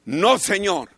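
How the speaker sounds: ordinary delivery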